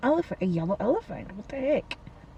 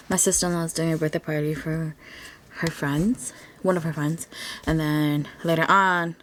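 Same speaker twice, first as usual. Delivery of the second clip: ordinary talk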